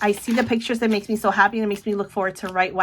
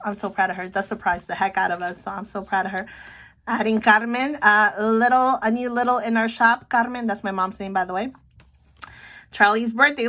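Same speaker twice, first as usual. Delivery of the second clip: usual speaking voice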